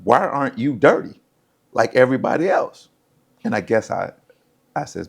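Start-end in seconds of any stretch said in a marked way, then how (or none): 0.0-2.9 raised, louder angry demand; retold cursing coach. Coach demanding of the clean quarterback, angry and raised. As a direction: bark it like an irate coach. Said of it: "he just started cursing".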